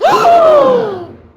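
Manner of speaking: loud, sharp collective gasp; the whole room gasping on cue; crowd gasp. Real, not acted. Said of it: "Can I get a gasp?".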